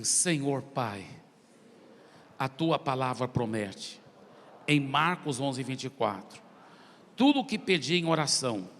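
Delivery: slow phrases with long pauses; led prayer. Real, not acted. Preacher leading the congregation in prayer, solemn and measured.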